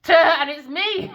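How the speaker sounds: loud high laugh through the words. Real, not acted. Reader laughing at her own jealousy, high and loud. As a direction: burst out laughing at herself. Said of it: "I'm fucking intimidated by her".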